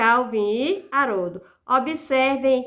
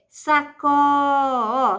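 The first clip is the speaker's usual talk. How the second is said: sacó cut short, then ó pushed out louder alone; stress on CÓ; 3 semitones higher, 3 dB louder, much slower than usual. Shown as a demonstration. Teacher isolating the stressed syllable of sacola, emphatic. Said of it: "sílaba tônica de sacola é o có".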